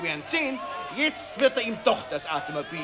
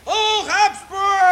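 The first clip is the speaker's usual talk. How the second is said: slow, loud, higher drawn-out cheer; patriotic cheer; 11 semitones higher, 9 dB louder, much slower than usual. Acted. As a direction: stretch the hurrah out. (gedehnter lauter Hochruf)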